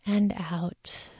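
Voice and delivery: breathy whispered exhale cue. Acted. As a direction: whisper it like a spa guide.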